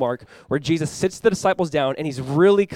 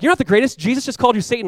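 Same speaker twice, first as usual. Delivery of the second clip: high, raised-voice comeback; mock indignant retort; 8 semitones higher, 4 dB louder, faster than usual. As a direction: shoot down a boastful friend, acting it out. Acted quarrel among disciples.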